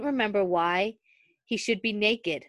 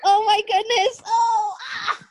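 embarrassed laughing cry; 14 semitones higher, 6 dB louder than usual. Real, not acted. Realizes embarrassing name slip.

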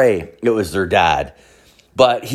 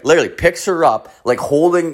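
normal talking voice

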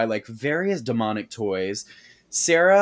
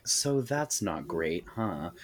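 deadpan; 7 semitones lower, 9 dB quieter, slower than usual. Retold or imitated. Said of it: "they're like".

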